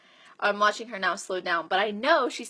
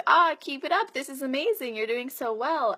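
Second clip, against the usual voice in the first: higher-pitched encouraging cheer; cheering her on; 5 semitones higher than usual. Retold or imitated. Coach to a client doing well on the treadmill, cheering. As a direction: cheer on a runner with an excited lift.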